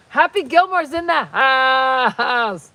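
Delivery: slow, loud, drawn-out hype call; announcer hype. Pretend. Introducing viewer Adam as Happy Gilmore, loud slow hype. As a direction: announce him like a star arriving.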